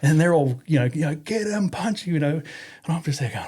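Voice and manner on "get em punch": very high egging on; mock crowd cheer. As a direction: shout them into it. Soldiers cheering the fight.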